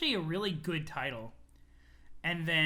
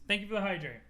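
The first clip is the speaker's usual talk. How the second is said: ordinary delivery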